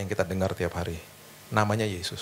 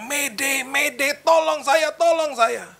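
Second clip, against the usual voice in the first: much higher and louder, mock mayday call; desperate radio shout; 5 dB louder, much slower than usual. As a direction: yell for help into the radio. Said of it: "Dia teriak".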